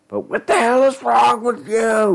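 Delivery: high, shrill frenzied yell; frenzied shriek. Acted. Frenzied shaking.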